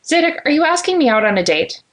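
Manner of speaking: high-pitched, pointed challenge; sharp question. A narrator's reading. Woman asks a colleague if this is a date, sharply. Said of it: "she responded sharply".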